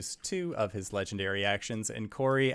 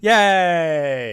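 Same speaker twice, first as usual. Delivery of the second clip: loud, high, drawn-out cheer; 8 semitones higher, 11 dB louder than usual. Real, not acted. Game master cheering the player on, cheering. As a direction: let out a big celebratory yay. Cheering a teammate's note-taking.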